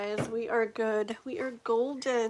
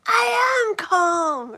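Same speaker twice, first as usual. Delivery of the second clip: much higher, loud, very slow strained yell; hammed-up higher yell "I am calm"; Adam Sandler yelling; 10 semitones higher, 11 dB louder, much slower than usual. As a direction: scream that you're calm. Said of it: "And he's like".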